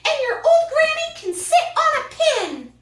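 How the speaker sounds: high, mocking pig yell. Third pig yelling an insult at the wolf. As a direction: taunt him about his granny. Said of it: "the third little pig yelled".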